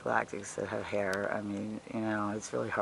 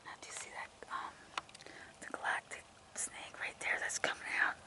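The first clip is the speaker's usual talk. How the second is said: soft unvoiced whisper; 7 dB quieter than usual. Real, not acted. Vlogger showing viewers the snake, whispered. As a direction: hush and point it out.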